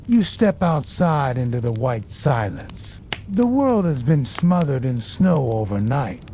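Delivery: usual speaking voice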